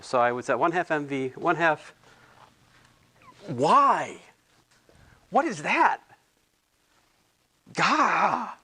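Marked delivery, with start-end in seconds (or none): 3.5-6.0 shocked disgust. Real, not acted. Shocked at ugly handwriting. 7.7-8.5 sharp disgusted gah, pitched up; a jolted cry of disgust at the page. Real, not acted. Recoils at the notes.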